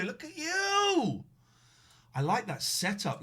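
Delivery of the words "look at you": excited. Real, not acted. Greeting guest on camera.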